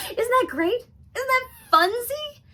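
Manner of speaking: high, loud squeal of excitement; giddy delight. Acted. Radio host delighted with her own jingle, giddy. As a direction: gush with bubbly, over-the-top glee.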